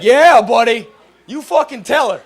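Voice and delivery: high, loud ironic heckle; heckling a misogynist comic with a high, loud mock cheer. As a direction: cheer him on like a drunk fan.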